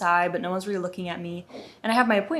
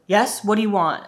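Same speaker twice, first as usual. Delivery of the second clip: louder, mock-impatient challenge; retold curt demand; 2 semitones higher, 8 dB louder than usual. Rude guard at podium to returning visitor, curt and impatient. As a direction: play the guard as put out by the visitor.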